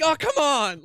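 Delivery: high, loud mock-exasperated yell; imitating an outraged theater crowd; yelled audience reaction. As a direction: shout at the screen like a moviegoer. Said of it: "like, yelling out loud".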